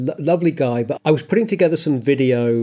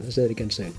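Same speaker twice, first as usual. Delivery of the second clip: repeated much softer, almost to himself; soft echo; 5 semitones lower, 9 dB quieter, much faster than usual. Real, not acted. Co-host echoing his own goodbye, much softer. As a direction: drop the repeat to a murmur.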